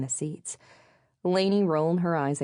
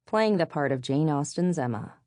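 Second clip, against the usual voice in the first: usual speaking voice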